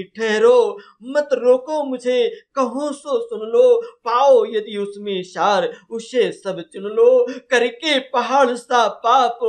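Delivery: loud, high, drawn-out verse recitation; Kaikeyi. Acted. Kaikeyi confessing her sin to the assembly, loudly declaimed. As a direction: declaim it slowly and forcefully, like a queen addressing an assembly. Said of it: "Kaikeyi कहती".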